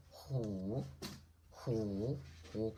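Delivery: "hu" low and soft, dipping then rising; falling-rising third tone. Shown as a demonstration. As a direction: say "hu" with a low scooped dip. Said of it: "is the falling rising tone".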